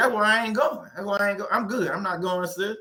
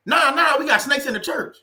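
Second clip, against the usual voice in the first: 1 mock church member; 6 semitones higher, 3 dB louder than usual. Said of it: "voicing church member explaining".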